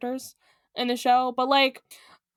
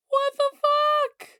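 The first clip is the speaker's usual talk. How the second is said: very high, slow, stretched disbelief; high drawn-out incredulity; mock shock; 13 semitones higher, much slower than usual. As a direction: squeal it slowly in exaggerated shock. Mock shock at trivia fact.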